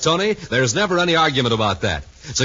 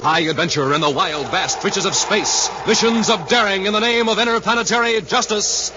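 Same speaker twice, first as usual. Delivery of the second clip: big, high, ringing announcer voice building to the hero's name; show announcer; 9 semitones higher than usual. Acted. Announcer opening the radio show for the listeners, high and ringing. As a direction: sell the adventure like a carnival barker.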